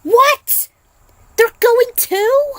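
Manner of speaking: high, loud shocked cry; shocked girl shrieking the news back. Acted. Her mouth and eyes widened.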